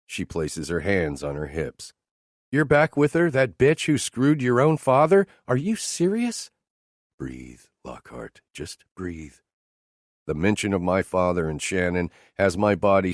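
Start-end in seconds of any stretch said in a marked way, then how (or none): 2.5-6.5 much higher, louder jealous outburst; woman furious her boss is back with his ex; outraged Teresa. Acted. 7.2-9.4 much softer, slow inner murmur; man calming himself, very quiet; self-calming. Acted.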